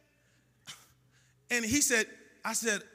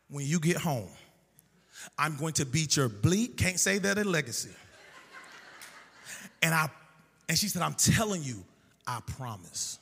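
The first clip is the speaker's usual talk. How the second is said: low, menacing mother's voice; threatening mom; 8 semitones lower than usual. Retold or imitated. Mom on the phone threatening her son, low and menacing.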